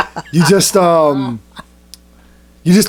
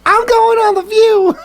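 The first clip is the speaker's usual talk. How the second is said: high, drawn-out squeal; playful high-pitched mock brag; mock excited announcement; slower than usual. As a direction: sing it out high in a silly put-on voice. Mock boast about TV appearance.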